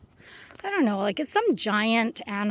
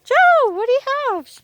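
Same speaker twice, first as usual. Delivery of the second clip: high, loud call to a dog; 12 semitones higher, 9 dB louder than usual. Real, not acted. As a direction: call the dog from across the trail. Calling the dog.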